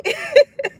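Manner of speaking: a quick squeal of laughter; short high giggle. Real, not acted. Reader laughing high after the J. Cole story.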